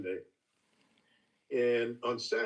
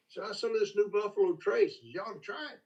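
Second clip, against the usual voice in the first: teasing Jimmy; 8 semitones higher than usual. Retold or imitated. Jimmy urging Booker to try it, high coaxing voice. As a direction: coax him into tasting. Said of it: "he said".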